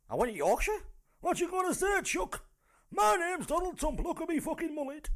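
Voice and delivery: high, loud, badly faked Yorkshire accent; Donald Trump gone Yorkshire. Comic failing at Trump's accent, slipping into loud high Yorkshire. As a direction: squawk it in broad mock Yorkshire. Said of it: "I don't know why he went to Yorkshire".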